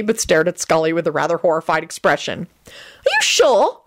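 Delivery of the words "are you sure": put-on high, slow frightened girl; scared girl voice. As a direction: ask it like a scared kid. Rosie frightened question.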